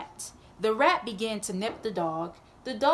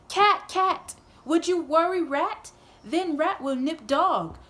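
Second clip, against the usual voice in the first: calling out, higher and louder; old woman calls to the cat, louder and higher; urgent plea; 5 semitones higher, 4 dB louder than usual. Acted. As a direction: beg the cat, pitch raised.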